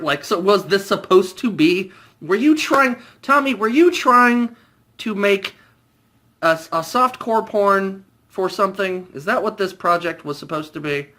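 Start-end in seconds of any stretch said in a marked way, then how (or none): 2.2-9.1 exasperated disbelief. Real, not acted. Exasperated question to Tommy.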